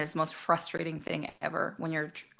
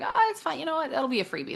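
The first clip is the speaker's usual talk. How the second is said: breezy brush-off; 8 semitones higher than usual. Retold or imitated. Shrugging off sending the invoice.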